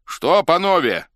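high, very loud public call; proclaiming. A narrator's reading. Escorts address the whole people, proclaiming. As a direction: hail the whole crowd. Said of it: "провозгласили во весь народ".

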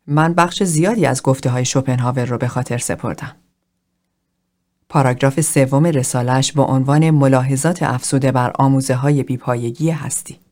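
usual speaking voice

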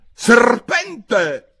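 high, loud screech; piercingly high, loud cry; pigeon's shriek. A narrator's reading. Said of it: "strillò il piccione".

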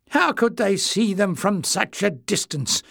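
put-on high, sneering young goblin. Young goblin Clef mocking the elder, sneering. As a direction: sneer it back. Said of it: "Clef sneered".

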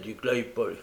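usual speaking voice

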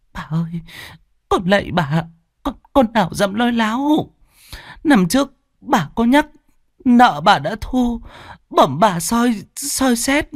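high, tearful begging; whimpering plea. A narrator's reading. Servant whimpers and pleads with the mistress. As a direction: whimper as you beg. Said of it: "Chị Miến mếu máo".

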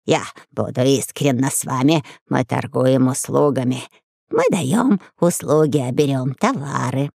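low, slow sly lizard. A narrator's reading. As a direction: speak as a cunning lizard, slowly. Lizard character voiced.

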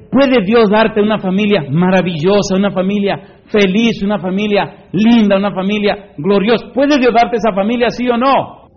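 high, loud, building to a ringing question; full-force preaching. Real, not acted. Preacher challenges congregation about their family, impassioned.

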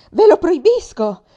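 very loud, very high, slow scream; furious scream. Acted. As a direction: scream the ban with all your rage. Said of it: "gridai io". (urlo furioso, fortissimo e molto acuto, lento)

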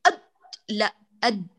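«أد» hit with too much force; «أد» press too hard on the د, the wrong way; over-forced ad. Shown as a demonstration. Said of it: "لا تقولي أد بقوة شديدة،".

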